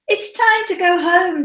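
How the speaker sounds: high-pitched calling voice; Timothy's dad. Acted. Dad tells Timothy to go home, high calling voice. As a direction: call a child home in a high lilt. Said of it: "Timothy's dad says".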